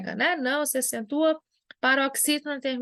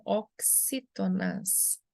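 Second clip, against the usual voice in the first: "oxítonas" slow and softer, syllable by syllable; 3 semitones lower, 5 dB quieter, much slower than usual. Shown as a demonstration. Teacher concluding the rule for students, slow syllable by syllable. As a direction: draw out each syllable of "oxítonas". Teacher spells out the term slowly.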